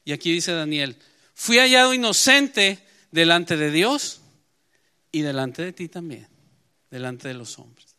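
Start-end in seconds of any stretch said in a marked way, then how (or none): 1.3-4.2 proclaimed loudly. Real, not acted. Preacher proclaiming Daniel's words.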